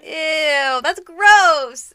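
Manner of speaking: loud, high, drawn-out disgust; big disgusted reaction, rising in pitch and volume; grossed out. Real, not acted. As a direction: recoil loudly from something revolting.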